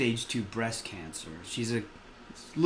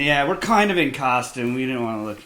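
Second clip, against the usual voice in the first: ordinary talk, nothing marked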